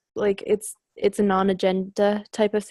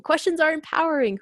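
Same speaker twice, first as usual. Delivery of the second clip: "Questions are empowering." raised higher, each word stressed; emphatic repeat; 7 semitones higher than usual. Shown as a demonstration. Telling the group to keep asking questions, emphatic and higher. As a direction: lift the line up and drive it home.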